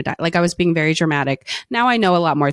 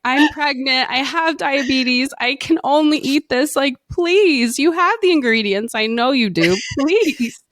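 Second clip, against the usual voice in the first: high, pleading retold sob story; begging the drive-thru worker through tears, pitched up; tearful pleading; 7 semitones higher, slower than usual. Pregnant woman begging Taco Bell worker, tearful plea. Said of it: "telling him my sob story".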